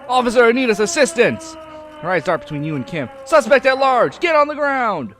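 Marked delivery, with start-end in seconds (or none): 0.1-1.5 high, loud call for backup; urgent police shout. Acted. Reads megaphone officer's urgent call. 3.2-5.1 high, full-volume cop yell; shouted police command. Acted. Voices woman shouting police commands.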